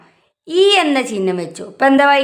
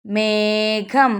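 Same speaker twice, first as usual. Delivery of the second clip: മേഘം very slow and softer; slow, softer model reading of മേഘം; reading demonstration; 5 dB quieter, much slower than usual. Shown as a demonstration. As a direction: stretch out മേ, then ഘം, gently. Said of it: "എന്താണ്?".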